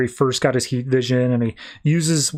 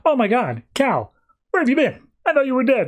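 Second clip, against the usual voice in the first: relieved surprise; 9 semitones higher than usual. Retold or imitated. Kara finds missing Superman.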